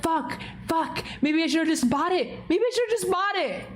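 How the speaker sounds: high, quick wail of regret; retold upset. Streamer kicking himself for not buying, mock upset. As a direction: whine it high, hammed-up regret. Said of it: "I was so fucking upset. I was like".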